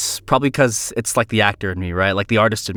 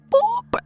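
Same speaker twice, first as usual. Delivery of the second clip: pitch-up boop; 4 dB quieter than usual. Retold or imitated. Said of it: "and then they're like".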